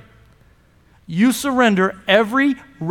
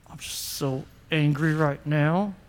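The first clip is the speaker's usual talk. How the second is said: low, soft, seething words pushed through the teeth; angry through teeth; 9 semitones lower, 11 dB quieter than usual. Shown as a demonstration. Pastor shows the congregation how anger talks, through teeth. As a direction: grit it out through clenched teeth. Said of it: "Talk through your teeth just like that".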